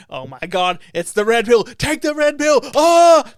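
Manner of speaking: hammed-up thrill building to a loud, high cry; mock hype. Mimics hyped fans' hoped-for reaction.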